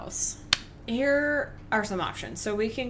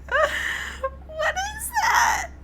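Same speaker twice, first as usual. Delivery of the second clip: shrieked, much higher and louder; real shriek; 4 dB louder, much slower than usual. Real, not acted. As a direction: scream it out in laughing alarm. Shrieks at the clip.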